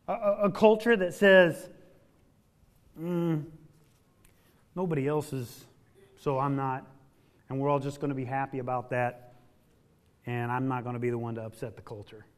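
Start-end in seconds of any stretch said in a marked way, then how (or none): 3.0-12.3 complacent voice. Retold or imitated. Voicing the culture of fear.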